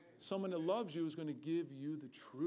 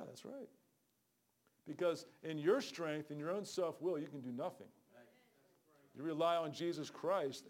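ordinary talk, nothing marked

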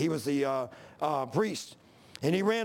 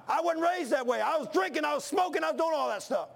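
high, ringing preacher's cry; preaching shout; 8 semitones higher than usual. Real, not acted. Preacher to congregation, shouting about his wild past. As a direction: belt it out from the pulpit.

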